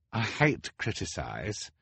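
high, soft, hesitant voice. A narrator's reading. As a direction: nervously venture an objection. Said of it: "Hugo said hesitantly".

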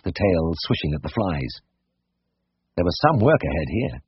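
ordinary delivery, nothing marked

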